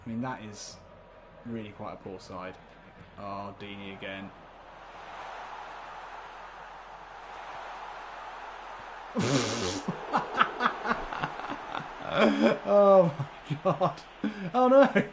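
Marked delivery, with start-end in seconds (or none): 4.3-12.1 long breathless silent laugh. Real, not acted. Watching the striker score again, wheezing with laughter. 12.1-15.0 slow, then much higher and louder, a shocked cry; shocked exclamation. Real, not acted. Realising he forgot the other subs, a shocked cry.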